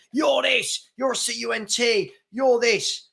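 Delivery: high, jeering troll abuse, imitated; mocking troll. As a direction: sneer like a chat troll hurling insults.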